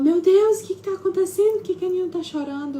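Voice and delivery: very high, loud panicked question; alarmed grandmother. Retold or imitated. Grandmother rushing in at the crying child, panicked. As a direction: burst in alarmed, pitch way up.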